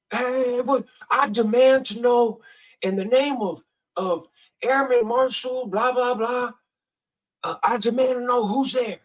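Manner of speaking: high, slow, pompous shouted demand; officious demand. Retold or imitated. Drunk neighbor confronting the narrator in the fog, pompous high shout. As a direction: puff yourself up like a self-appointed lawman.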